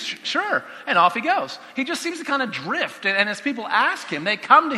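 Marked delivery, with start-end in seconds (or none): 0.0-0.6 offhand high "sure" with a stammer; lazy shrug of a yes. Retold or imitated. Preacher mimicking an easygoing Jesus, offhand yes. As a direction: agree without a second thought.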